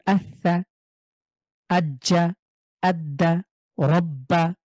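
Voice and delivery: আস্সা with the স held as two, once closing and once opening; slow, stress the shadda by pressing the consonant twice; doubled letter. Shown as a demonstration. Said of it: "সেটাকে আমরা দুবার পড়বো".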